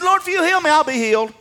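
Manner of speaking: very high, loud declaration; confident cry. Acted. Preacher as the prophet asking for healing, confident shout. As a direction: shout it to God with total certainty. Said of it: "What confidence did Jeremiah have?".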